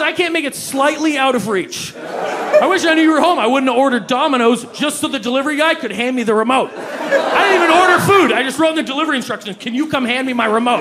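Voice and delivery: loud, high exasperated rant; ranting shout. Retold or imitated. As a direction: yell it like a lazy martyr. Ranting at fit roommate.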